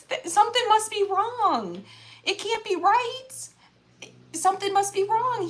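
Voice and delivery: high-pitched, grieving protest at God; anguished angry protest. Retold or imitated. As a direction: relive the hurt and anger of losing a sister. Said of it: "I was angry".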